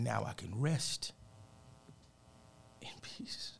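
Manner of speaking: slow, fading into a whisper; slow down, pause, whisper the last words; hushed realization. Retold or imitated. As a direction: reverent retold words, trailing to breath. Retold father's words, now understood.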